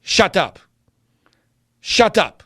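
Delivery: deadpan imitation of a blunt two-year-old; curt mock-toddler command; straight-faced toddler. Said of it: "with a straight face, she says".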